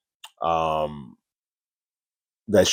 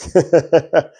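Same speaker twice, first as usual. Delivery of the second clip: a higher, louder mock laugh in a put-on voice; imitated laugh; 9 semitones higher, 4 dB louder than usual. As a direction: laugh it off as if it were a joke. Deb laughing at killer confession.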